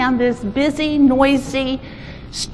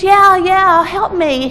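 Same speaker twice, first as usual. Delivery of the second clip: louder, higher mock shout; calling back; 6 semitones higher, 5 dB louder, slower than usual. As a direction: answer urgently from down a hole. Trapped boy calls back up.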